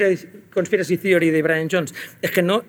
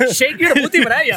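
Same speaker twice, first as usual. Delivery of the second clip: much higher, louder hammed-up Mick impression; 9 semitones higher, 5 dB louder, much slower than usual. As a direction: ham it up like a cheesy frontman calling his bandmate. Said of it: "Mick decía".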